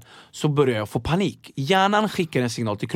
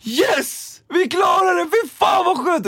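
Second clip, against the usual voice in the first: triumphant cheer; 4 dB louder, slower than usual. Retold or imitated. Relief after the ride.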